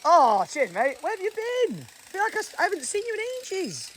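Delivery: overjoyed to see him again; excited greeting. Real, not acted. Greeting Papa Ken back on the land, high and thrilled.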